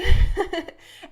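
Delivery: loud, high burst of laughter; real, sudden high laugh. Real, not acted. Laughs at own admission.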